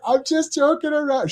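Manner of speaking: high, placating protest; defensive backpedalling. Retold or imitated. Retelling an excuse to the unhappy coworker, high-pitched placating.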